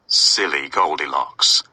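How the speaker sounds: ordinary delivery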